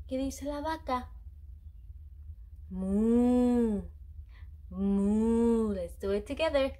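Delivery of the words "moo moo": cow moo imitation. Shown as a demonstration. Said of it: "What does the cow say?".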